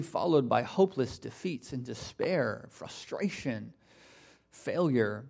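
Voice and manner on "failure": ordinary talk, nothing marked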